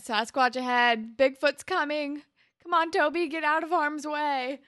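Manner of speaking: slow, loud mock warning cry; mock alarm call. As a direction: sound the alarm, hammed up. Quoting JT's alarm call.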